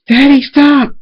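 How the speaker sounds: very high, loud, desperate scream. A narrator's reading. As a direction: scream for Daddy to stop. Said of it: "Schooler screams".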